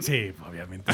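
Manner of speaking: ordinary talk, nothing marked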